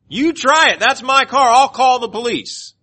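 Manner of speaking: high, loud shrieked threats; imitating her screaming at him; mock yelling. Retold woman yelling at boyfriend.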